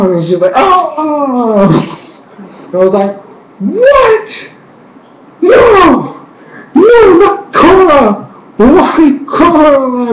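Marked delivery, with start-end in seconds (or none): 0.5-1.7 long high falling wail; imitated scream. Imitates Korra crying out. 3.6-10.1 very high, slow drawn-out cries; distraught fan wailing. Retold or imitated. Acts out her devastated reaction.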